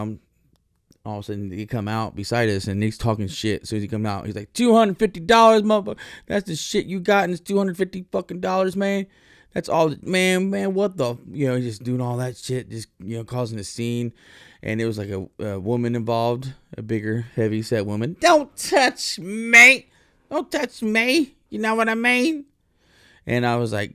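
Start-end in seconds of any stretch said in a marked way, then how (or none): 4.5-11.2 high, loud imitated yelling from a tall guy; mock angry yell. 18.2-22.4 high, loud, slow put-on woman's shout; mock woman shouting.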